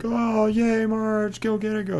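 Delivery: high-pitched, half-hearted crowd cheer; mock cheering. Audience member cheering on Marge, high mock cheer.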